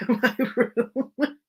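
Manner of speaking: words dissolving into laughter; laughing. Real, not acted. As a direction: crack up while finishing the sentence. Cracks up mid-sentence.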